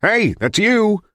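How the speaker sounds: high, slow drawn-out call; calling across hall. A narrator's reading. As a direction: holler to someone down the hall. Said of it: "Friedman called from across the hall".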